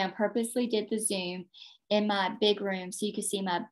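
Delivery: ordinary delivery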